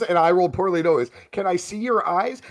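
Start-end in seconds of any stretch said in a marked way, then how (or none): 1.3-2.4 higher-pitched innocent asking; mimicking the doctor's request. Game master contrasting harmless eye exam with force feeding, mock innocent. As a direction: lightly parody a harmless little question. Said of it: "Now I'm gonna sound like".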